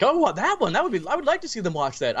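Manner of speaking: high, eager mock reaction; mimicking a keen audience request; imitated curious members. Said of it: "were like".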